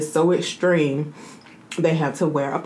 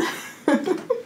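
breathy giggle; 14 semitones higher, 5 dB quieter than usual. Real, not acted. Giggle after sing-song.

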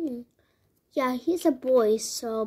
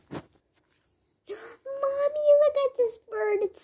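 put-on awed little kid; 11 semitones higher than usual. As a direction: draw it out like a kid in love with the bird. Child spots bird in shop.

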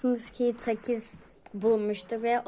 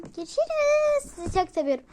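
sing-song goodbye; 5 dB louder than usual. Real, not acted. Cheery vlog sign-off.

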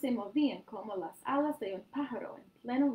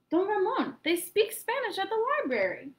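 high, loud proud announcement; excited declaring; 10 semitones higher, 6 dB louder than usual. A narrator's reading. Boy declaring the news to the grocer, excited. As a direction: announce it to the whole shop. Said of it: "declared Hildemar".

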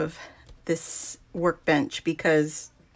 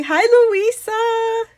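excited greeting; 7 dB louder, slower than usual. Real, not acted. Greeting a viewer on a live stream.

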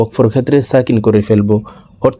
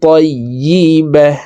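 slow recitation stopping on h; 5 semitones higher, much slower than usual. Shown as a demonstration. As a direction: recite slowly, stop with a breathy হ. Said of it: "দেখুন, এইভাবে পড়তে হবে". (ওয়াকফে হা সাকিন)

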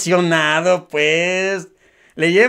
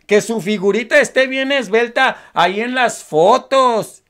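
ordinary delivery

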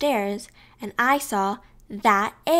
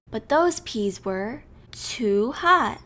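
usual speaking voice

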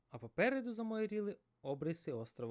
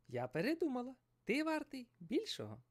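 fish voice; 12 semitones higher than usual. Acted. Fish character Dori speaking.

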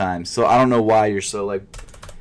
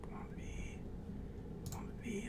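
pleading whisper; 23 dB quieter than usual. Real, not acted. Pleading with page to load.

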